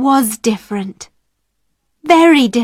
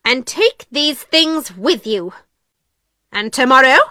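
stern, commanding headmistress; cold; 2 semitones higher than usual. A narrator's reading. Miss Minchin confiscating the party things, cold. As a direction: lay down orders coldly. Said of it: "Miss Minchin said coldly".

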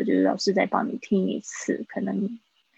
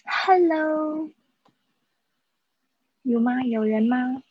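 calling out; 4 semitones higher, slower than usual. Real, not acted. Calling for absent students to answer.

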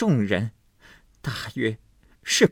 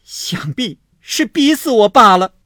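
shrill furious scolding, much higher and louder; 7 semitones higher, 6 dB louder than usual. A narrator's reading. Jealous wife scolding the maid, shrill and furious. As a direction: berate the maid in a jealous rage. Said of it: "又骂说".

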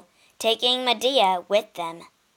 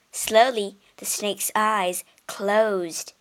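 ordinary delivery

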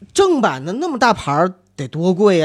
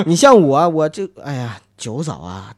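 normal talking voice

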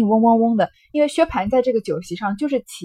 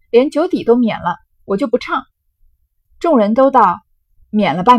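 loud, lively storytelling voice acting out a drinking game; dramatic novel reading; 6 dB louder than usual. Teacher reads the drinking-game scene aloud, loud and lively. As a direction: read the scene with relish, loud and animated.

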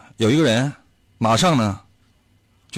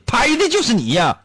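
mock angry God; 9 semitones higher than usual.

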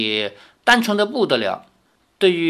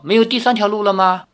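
quick, high-pitched plea; comic hammed-up pleading; plaintive question; 7 semitones higher, 4 dB louder, faster than usual. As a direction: ask hopefully for another way out. Hero pleads in funny game line.

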